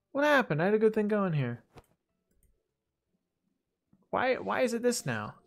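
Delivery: high, loud and baffled, a bit fast; what just happened to my work; exasperated outburst. Real, not acted. Tool suddenly changed on him.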